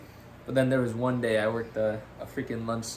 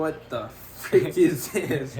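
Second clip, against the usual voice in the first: slow, drawn-out disbelief, a bit higher and louder; imitating a boss who can't believe what's on the plate; disgusted chef; 4 semitones higher, 4 dB louder, much slower than usual. Chef tasting the salad, slowly mock-disgusted. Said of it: "but he's just like".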